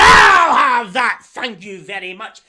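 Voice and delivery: loud, gloating sultan grab; snatch it with smug glee; lightly voiced greedy sultan. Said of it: "And he said".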